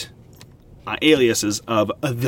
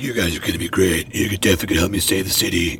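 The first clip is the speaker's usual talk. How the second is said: much lower, mock hero voice; Batman voice; 7 semitones lower, faster than usual. Batman praising his new helpers, low hero voice. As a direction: drop low to play the approving superhero. Voicing Batman encouraging Gotham pair.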